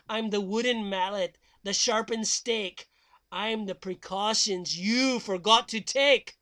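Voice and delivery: slow, dramatic horror recital; spooky poem recitation. Acted. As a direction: intone it like a ghost story.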